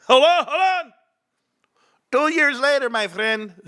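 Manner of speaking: teasing build to vindication; gleeful gloat. Acted. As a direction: savour the told-you-so.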